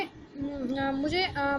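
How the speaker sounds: usual speaking voice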